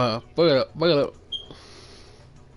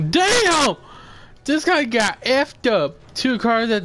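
excited shout; 9 semitones higher, 3 dB louder than usual. Real, not acted. Gamer shouting at a double car crash, loud and high. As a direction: shout it out at the wreck.

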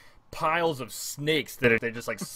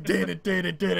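higher-pitched dun-dun chant; mouthed dramatic battle riff; imitated orchestral music; 6 semitones higher than usual. Gamer mimicking metal orchestra music, punchy.

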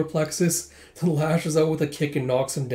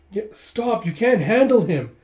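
urgent warning; 7 semitones higher, 5 dB louder than usual. Acted. Character warns friend to stop.

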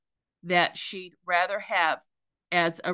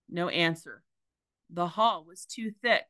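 plain reading voice

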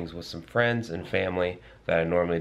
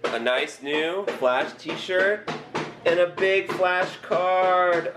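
excited; 8 semitones higher, much slower than usual. Real, not acted. Showing off the gift slowly.

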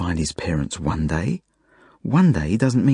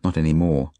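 mildly bitter afterthought; understated bitterness, kept inward; 3 semitones lower than usual. A narrator's reading. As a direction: think it with a touch of resentment. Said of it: "I think bitterly".

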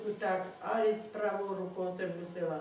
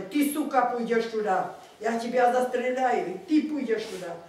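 loud, higher, menacing threats; guard yelling; 4 semitones higher, 8 dB louder than usual. Retold or imitated. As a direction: bark the orders and threat to shoot her. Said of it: "A on křičí na mě".

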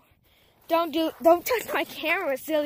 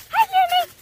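high, loud sing-song greeting to a dog; dog-talk voice; 8 dB louder than usual. Real, not acted. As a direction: squeal hello to the puppy.

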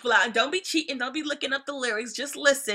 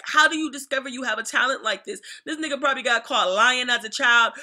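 normal talking voice

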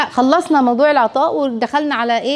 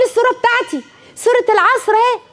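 put-on proud little child; 10 semitones higher than usual.

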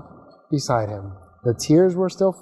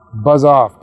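loud dismissive bellow; 8 dB louder than usual. Acted. Big rocket Zor tells little Gus to leave, loud bellow. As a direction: shove him away with your voice. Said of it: "bellowed Zor".

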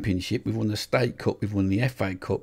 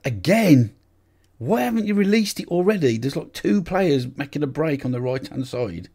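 much louder and higher, exasperated yell; shouting; 6 semitones higher, 5 dB louder than usual. Real, not acted. Manager shouting at the screen for a release. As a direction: bark at the passer who held on too long. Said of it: "I don't know why I'm shouting".

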